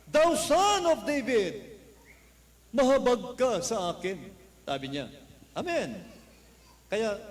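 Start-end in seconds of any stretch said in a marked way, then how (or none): 0.1-4.2 high, loud, slow plea; louder cry. Retold or imitated. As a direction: cry out even louder despite the crowd. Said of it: "Lalo siyang sumigaw ng mas malakas". (mas malakas at mabagal na sigaw)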